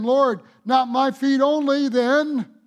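mock-eager Peter, lightly voiced; comic Peter. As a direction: play Peter a bit over-eager. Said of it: "He's hilarious".

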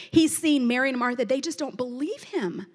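ordinary delivery

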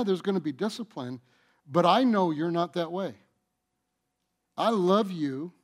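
ordinary delivery

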